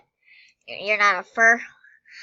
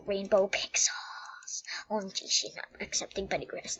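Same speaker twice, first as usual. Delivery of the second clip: soft unvoiced whisper; hushed aside, breathed out; 8 dB quieter than usual. Real, not acted. Whispering aside while roleplaying.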